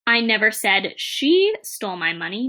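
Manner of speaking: "she" stretched out and punched; single out "she" with a long, strong stress. Shown as a demonstration. Teacher shows it was someone else, stress on "she". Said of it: "as different words are stressed".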